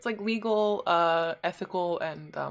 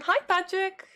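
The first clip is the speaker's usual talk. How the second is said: cute voice; 11 semitones higher than usual. Pretend. Mock greeting in cute voice.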